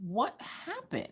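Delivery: soft, high, slow question; retold gentle concern. Mom asks crying daughter, retold soft slow concern. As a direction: coax it out of an upset child, slowly and softly.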